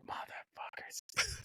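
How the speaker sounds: slow, stunned whisper; hushed, drawn-out curse; whispered realisation. Retold or imitated. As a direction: whisper it slowly as the prank dawns. Realising friends pranked him, retold.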